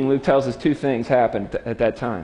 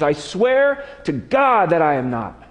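sworn denial; 4 semitones higher than usual. Acted. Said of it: "with an oath".

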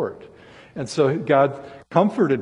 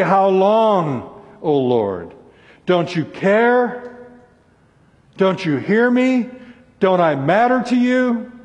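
raised, pleading cry of complaint to God; lamenting complaint; 5 semitones higher, 4 dB louder, slower than usual. Acted. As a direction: press each question higher, drawing it out. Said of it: "are complaints, laments".

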